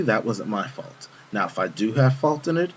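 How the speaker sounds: plain reading voice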